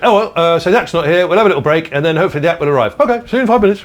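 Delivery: loud, high, jolly compere calling from stage; hammed-up loud compere; compere's voice. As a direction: boom it out like a club compere. Compere Eugene voiced in anecdote.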